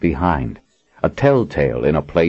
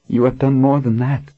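ordinary delivery

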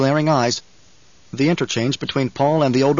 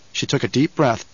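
plain reading voice

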